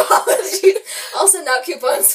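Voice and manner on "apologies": higher, louder word through laughter; laughing apology. Real, not acted. Apologizing to podcast listeners, laughing. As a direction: laugh it out, pitched up.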